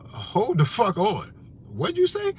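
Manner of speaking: sudden high incredulous "what'd you say"; startled guest at dinner, half-laughing; mock startled. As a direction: snap to disbelief, pitch jumping up. Mock reaction to drunk aunt.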